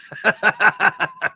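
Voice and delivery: loud, high laugh; real laugh; burst of laughter. Real, not acted. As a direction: laugh out loud, caught off guard. Laughing at chat remark.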